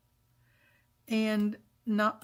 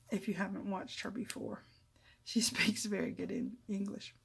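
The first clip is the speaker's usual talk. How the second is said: soft unvoiced whisper, secretive; whispered aside; 3 semitones lower, 9 dB quieter than usual. Real, not acted. Crafter whispering a teasing aside to viewers. Conspiratorial aside about Margaret.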